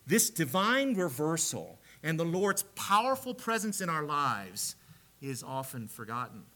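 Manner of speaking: ordinary delivery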